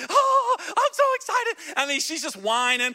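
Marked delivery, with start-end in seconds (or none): 0.1-1.7 much higher, loud squealing dog voice; excited dog voice. Pretend. Pastor voicing his little dog thrilled he is home, squealing high. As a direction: voice the dog bouncing with joy.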